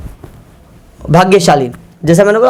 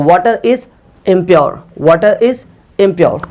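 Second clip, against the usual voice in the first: slow dictation; much slower than usual. Shown as a demonstration. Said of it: "पानी अशुद्ध है तो".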